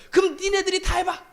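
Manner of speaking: annoyed pastor snapping at grumbling members; mock angry retort. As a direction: throw up your hands and dump it on them. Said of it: "전라도 목사가 화가 난 거예요".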